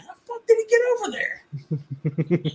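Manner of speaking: slow, high incredulous cry; baffled. Retold or imitated. Player staring at the stray landscaper, slow high disbelief. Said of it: "I'm just like".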